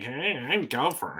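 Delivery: slow, soft nasal impression; nasally Gopher voice. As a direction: pinch it through the nose, slowly. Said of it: "had a very nasally way of talking".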